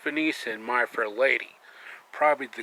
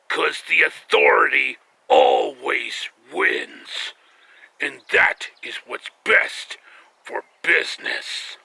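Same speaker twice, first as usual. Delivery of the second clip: slow, menacing, dropping to a whisper; loud then hushed villain threat; Triple H impression; 5 dB louder, slower than usual. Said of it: "Triple H was there".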